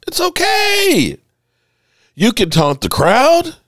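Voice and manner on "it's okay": very high, slow sarcastic it's okay; mock dismissive. Fed-up host mimicking the league's shrug at Rodgers. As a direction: sing it out like a shrugging official.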